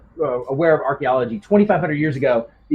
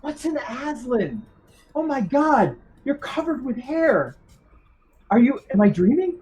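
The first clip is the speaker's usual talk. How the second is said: high, panicked gasping questions; a woman waking into a nightmare; frightened woman; 8 semitones higher, 3 dB quieter than usual. Acted. GM voices the woman, shocked.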